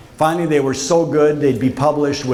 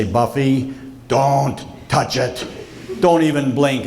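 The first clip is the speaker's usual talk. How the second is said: slow warning; slower than usual. Retold or imitated. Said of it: "only this time I say".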